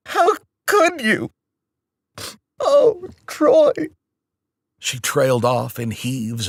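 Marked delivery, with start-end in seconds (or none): 0.1-3.7 high, slow, sobbing wail; crying out her son's name in grief; bursting into tears. Acted. Mother sobbing over the phone about her son, crying. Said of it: "She burst into tears".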